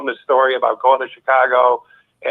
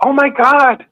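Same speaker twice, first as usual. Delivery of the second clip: jumps much higher, a stunned "oh my God"; retelling their shocked reaction, voice leaping up; shocked gasp; 13 semitones higher, 4 dB louder, slower than usual. Retold or imitated. Recalling the congregation's shock, an incredulous cry.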